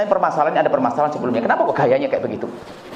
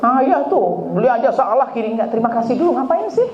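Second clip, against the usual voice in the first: higher, whiny complaint at husband; nagging wife; 8 semitones higher than usual. Retold or imitated. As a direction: nag the husband for buying wrong. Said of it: "Jadi termasuk suami, dan jangan gampang nyalahan". (istri mengomel kepada suami dengan nada tinggi)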